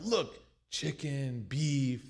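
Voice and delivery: slow, drooling greedy pointing. Retold or imitated. Mock pilgrim drooling over the buffet food. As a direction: savour each dish like you're drooling. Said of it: "the little drool".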